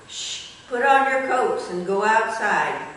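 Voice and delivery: ordinary delivery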